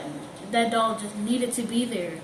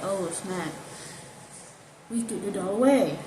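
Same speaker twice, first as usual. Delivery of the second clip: ordinary delivery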